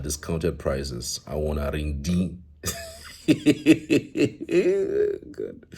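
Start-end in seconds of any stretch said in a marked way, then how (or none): 2.6-5.3 long, high-pitched giggling fit; giggle. Real, not acted. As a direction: burst into high, helpless giggles. Amused by comment just read.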